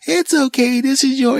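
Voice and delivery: put-on very high soothing baby-talk voice; mother cooing. Mother soothing her crying baby, very high coo. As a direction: lift way up and coo it. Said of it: "And the mother goes".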